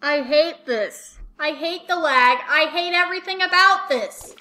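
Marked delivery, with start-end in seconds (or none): none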